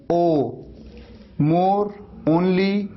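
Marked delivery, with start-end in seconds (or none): 1.4-2.9 more, only stretched very slow; slow teaching demo of more and only; drawn-out o. Shown as a demonstration. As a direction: hold the ओ in more and only long. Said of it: "ये है stop".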